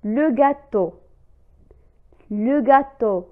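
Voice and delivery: "Le gâteau" spoken clearly with a firm T; model word reading. Shown as a demonstration. Teacher reading "Le gâteau" to the class, clear. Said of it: "مثال ثالث".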